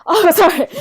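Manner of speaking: bursting into giggles mid-apology; laughing apology. Real, not acted. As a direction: laugh it off, embarrassed. Embarrassed laugh over word mix-up.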